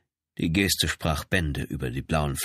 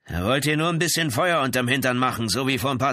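high, forceful character voice; threatening angry brother; 7 semitones higher, 4 dB louder than usual. Acted. Brother explains the gun to police, heated. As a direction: answer as the agitated brother, pitched up.

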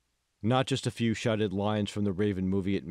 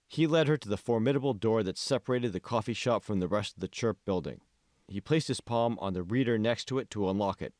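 ordinary delivery, nothing marked

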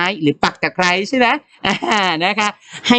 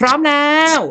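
rallying call; 10 semitones higher than usual. Real, not acted. Rallying pupils to read.

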